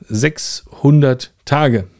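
both words slow and deliberate; slow emphasis. Real, not acted. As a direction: drag out "Sechshundert Tage" for weight. Repeats number for emphasis. ("Sechshundert Tage" langsam betont)

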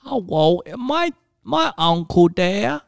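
high, slow silly cartoon voice; Elmer Fudd voice. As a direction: talk like a dim cartoon hunter. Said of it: "like, Elmer Fudd".

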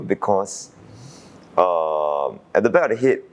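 ordinary talk

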